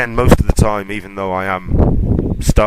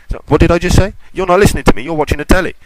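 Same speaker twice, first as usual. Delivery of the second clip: higher-pitched imitation of a nagging wife; mock wife nagging; 8 semitones higher, much faster than usual. Wife scolding her husband for watching telly instead of listening, mock nagging. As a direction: play the wife having a go at him, a bit higher.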